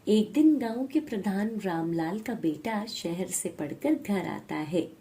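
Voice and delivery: plain reading voice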